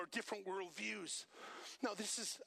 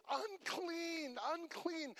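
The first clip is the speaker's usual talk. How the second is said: higher, mournful call of unclean; leper's call; 7 semitones higher, much slower than usual. Retold or imitated. Preacher voicing the leper calling in the street, mournful call. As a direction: call out a warning as an outcast. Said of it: "he has to say".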